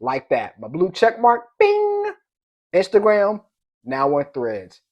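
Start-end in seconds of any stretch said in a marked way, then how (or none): 1.6-2.1 high, ringing "bing"; imitated bing. Host showing his check mark pop up, high bing. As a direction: make a high notification chime with the voice.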